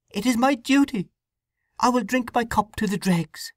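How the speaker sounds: put-on a troubled young wife confessing; young woman Sue. Sue resolves.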